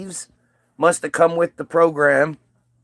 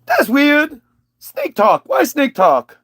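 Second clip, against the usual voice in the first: high, loud simple-minded voice; high, broken-English dimwit; dim Eve; 8 semitones higher, 3 dB louder, slower than usual. Retold or imitated. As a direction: play Eve as a dopey caveman type. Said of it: "And Eve's like".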